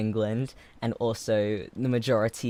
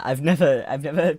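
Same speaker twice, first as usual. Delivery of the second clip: usual speaking voice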